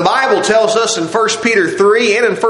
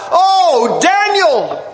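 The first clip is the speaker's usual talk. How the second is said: lamentable cry; 7 semitones higher than usual. Acted. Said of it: "with a lamentable voice".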